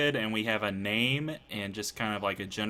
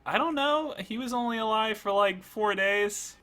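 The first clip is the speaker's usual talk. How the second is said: higher, flustered skeleton; 9 semitones higher than usual. Acted. Skeleton telling Spad about Kevin, high flustered voice. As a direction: shrug it off nervously.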